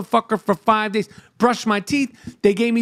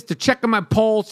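ordinary delivery

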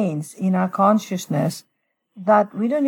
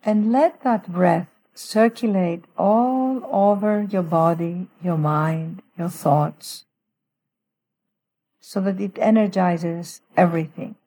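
guided meditation. Shown as a demonstration. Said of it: "let me just walk through a little prayer with our listeners".

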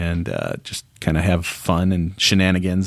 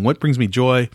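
normal talking voice

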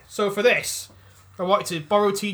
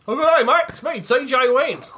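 put-on Australian accent; 5 semitones higher, 4 dB louder than usual. Voicing the Australian ancestor of the character in Australian accent. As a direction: hail them like a cheerful Australian bloke.

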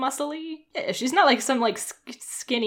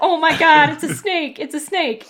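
put-on excited kids; 6 semitones higher, 6 dB louder than usual. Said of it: "And they're like".

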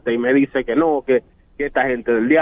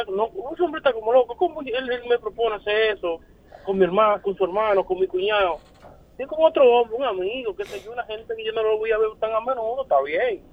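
higher-pitched, softer woman's complaint; lightly voiced indignant woman; mimicking the woman; 8 semitones higher, 4 dB quieter than usual. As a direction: play her outraged, then bargaining. Voicing the woman in his story.

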